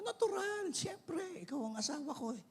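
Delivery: high-pitched, playful "but of course"; emphatic reassurance. Acted. Husband answering his wife about attending her church anniversary, emphatic reassurance. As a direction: jump up high, then ease down softly.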